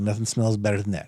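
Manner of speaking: mock old guy, low and soft; low old guy's voice. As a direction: drop it low and quiet for his quote. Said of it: "He's like".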